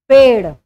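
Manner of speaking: पेड़ said with a flapped ड़, tongue striking and flipping back; पेड़ with a clear flap; retroflex flap demo. Shown as a demonstration. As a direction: say पेड़ loudly, flicking the tongue for ड़. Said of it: "अगर हम उच्चारण करें".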